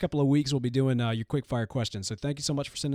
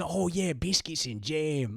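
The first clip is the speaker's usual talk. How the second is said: slow, hammed-up fake Cockney accent; terrible mock Cockney accent; 2 semitones higher, slower than usual. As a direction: drag out a bad Cockney "biscuits and jam" as a joke. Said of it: "terrible, terribly offensive accent".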